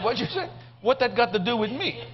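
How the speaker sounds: Bill's retort. Retold or imitated. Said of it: "He said".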